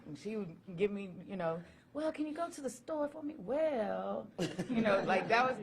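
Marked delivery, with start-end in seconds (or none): 1.9-3.4 higher voice of an asking mother; voicing mom coaxing a favor; mother's voice. Son quotes his mother asking.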